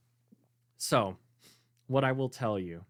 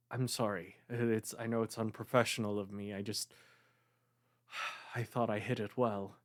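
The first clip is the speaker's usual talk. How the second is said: subdued, soft apology with a sigh; low and quiet, sadness barely held in; sad; 4 semitones lower, 6 dB quieter than usual. Acted. Grieving woman apologizing to the seer who saw through her, subdued and sad. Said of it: "why you are so sad".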